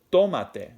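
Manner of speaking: "tómate" with the first syllable punched high and loud; stress on first syllable. Shown as a demonstration. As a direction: hit the "tó" in "tómate", let the rest fall. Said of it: "accent written over the O".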